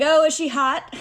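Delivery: high, loud blurted question; retold awkward overeager question; nervous blurting. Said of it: "This is just me nervous talking".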